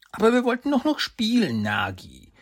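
put-on high, sad little-boy plea; a disappointed little boy; sad child. A narrator's reading. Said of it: "antwortet Saki traurig".

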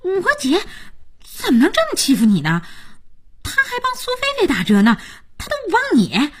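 high-pitched, outraged protest; indignant. Acted. Jiayin faults her sister for Liao Yu's sake, indignant. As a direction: protest on his behalf, pitch leaping.

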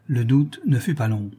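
ordinary delivery, nothing marked

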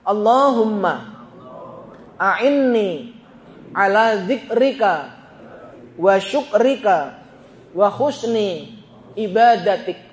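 slow prayer in short phrases with pauses; leading recitation. Shown as a demonstration. Preacher leads congregation in the dua, slow recitation. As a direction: lead them phrase by phrase, unhurried. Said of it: "Ayo dibaca bareng".

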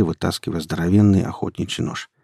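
ordinary delivery

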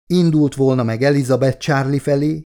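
ordinary delivery, nothing marked

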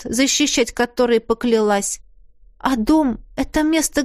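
ordinary delivery

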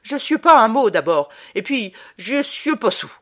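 put-on loud, offended drunk denial; a drunk peasant insulted by the girl; angry drunk protest. A narrator's reading. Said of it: "Pavilly se fâcha".

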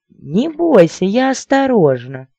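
high, loud coaxing voice; squeally lady. A narrator's reading. Lady to her nervous suitor, squeally. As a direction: coax him in a high squeal. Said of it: "тонкий визгливый голосок".

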